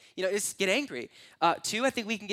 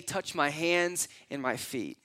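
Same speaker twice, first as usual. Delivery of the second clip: slow emphasis; slower than usual. Shown as a demonstration. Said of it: "why did he say".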